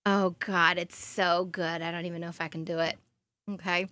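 normal talking voice

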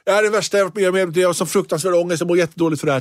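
mocking her own anxiety before the show, hammed-up; mock anguish. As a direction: rattle off hammed-up despair. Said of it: "som att livet gick från så här".

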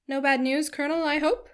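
pry with a lifted, rising question; a hint of a nosy matron; inquisitive matron. A narrator's reading. Mrs Jennings's prying question on his return.

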